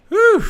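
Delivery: loud, high whew; high whoosh of relief. Real, not acted. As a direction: let out a sharp high whew. Excited exhale.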